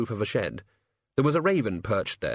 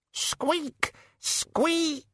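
very high questioning squeak; tiny shrill squeak; high rat squeak. Acted. Death of Rats squeaking after the sledge, very high.

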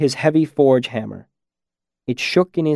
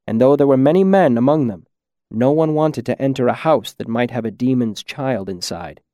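ordinary delivery

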